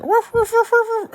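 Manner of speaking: high, loud woofs, like a dog; imitated barking.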